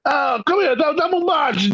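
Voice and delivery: high mock Italian old-timer; hammed-up old Italian man beckoning. Old neighbor beckoning the teen, high mock Italian. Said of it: "speaking to me in Italian".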